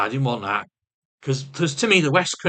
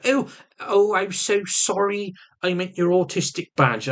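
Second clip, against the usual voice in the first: imitating his partner; 8 semitones higher than usual. Partner apologising to him in the car, imitated apologetic voice. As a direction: put on her higher apologetic voice. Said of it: "She goes".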